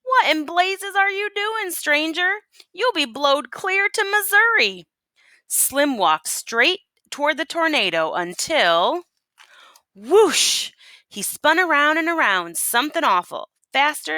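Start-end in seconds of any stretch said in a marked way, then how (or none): 0.0-4.9 much higher, alarmed townsfolk voice; panicked folks shouting at Slim; shouted. A narrator's reading. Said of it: "Folks shouted". 9.9-10.7 whoosh. A narrator's reading. Tornado grabs Slim.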